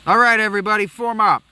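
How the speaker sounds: loud, high rallying shout; commander's call. Acted. Commander shouting at apprentices, loud and high. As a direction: bark the order to the troops.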